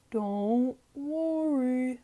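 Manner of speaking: put-on slow, calm old grandpa voice; old grandpa sheep. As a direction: soothe them slowly like a wise old grandfather.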